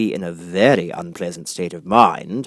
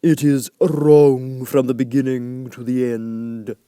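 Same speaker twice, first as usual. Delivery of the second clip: slow, louder, firm; decided caterpillar; 4 semitones higher, 6 dB louder, much slower than usual. A narrator's reading. Caterpillar judges Alice's poem, slow and decided. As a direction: state it slowly with finality. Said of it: "said the caterpillar decidedly".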